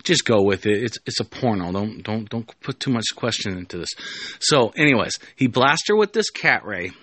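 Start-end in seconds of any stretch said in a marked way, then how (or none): none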